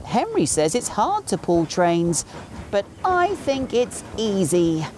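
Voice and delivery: mildly boastful. A narrator's reading. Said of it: "he thought importantly".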